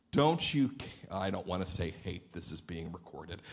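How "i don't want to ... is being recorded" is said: quiet aside. Real, not acted. Aside, dropped voice.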